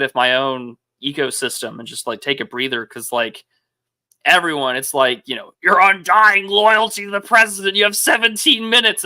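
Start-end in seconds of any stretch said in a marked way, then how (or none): 5.6-9.0 higher, louder mock announcement; mock demand. Host mocking a demand for loyalty to the president, hammed up. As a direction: put on an over-the-top demanding voice.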